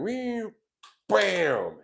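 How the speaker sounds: a high swooping whee, then a loud bam; flying cup sound effect. Retold or imitated. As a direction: whoosh it up high and land it with a punch. Mimics the flying cup hitting.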